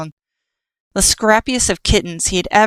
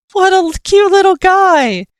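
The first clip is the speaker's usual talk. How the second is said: much higher, loud squeal; gushing; 13 semitones higher, 7 dB louder than usual. Acted. Cross gushes over his kitten, high and loud. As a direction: gush over the little kitten.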